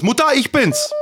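real high, loud call to another room. Real, not acted. Son calling his mother during the podcast, calling out. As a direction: holler up for your mother.

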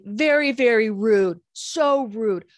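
put-on slow, loud, stern old-man scolding; mimicking the neighbor's scolding, slow loud grumpy voice. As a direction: drag out very rude like a disgusted elder. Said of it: "he's getting angrier".

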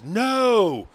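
put-on bratty kid yelling back; imitating a child yell. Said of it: "fucking yells".

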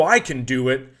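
normal talking voice

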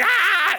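short, high comic shriek; quick mock scream while fleeing in fright; reenacted scream. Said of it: "And I scream".

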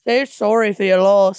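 Australian accent. Shown as a demonstration. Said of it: "I did that better than you".

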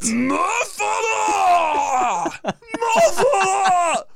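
high, slow furious shout; screaming. Retold or imitated. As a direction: scream it at the camera. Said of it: "Just screaming at me".

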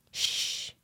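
shushing. Retold or imitated. Shushing in a tense story.

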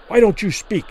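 sharp burst of anger; angry demand. A narrator's reading. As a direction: demand an answer angrily. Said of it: "Kelly demanded angrily".

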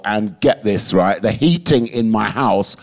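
normal talking voice

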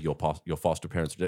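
lightly voiced Terminator, low, soft and fast; rushed low deadpan imitation. Terminator telling boy the news, low deadpan imitation. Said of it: "he's like".

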